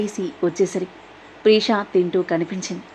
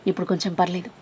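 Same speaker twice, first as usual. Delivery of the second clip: detached; 7 dB quieter, faster than usual. Acted. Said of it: "నిర్లిప్తంగా".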